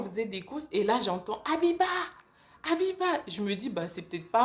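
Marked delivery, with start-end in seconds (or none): 1.5-3.1 appel aigu à travers la foule; héler quelqu'un de loin. Retold or imitated. Quelqu'un l'appelle dans la rue. (appeler fort, voix haute, dans la rue)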